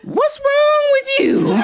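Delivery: high, laughing cry of disbelief; shocked and amused at once; startled great-grandma. Retold or imitated. Startled great-grandma scolding child.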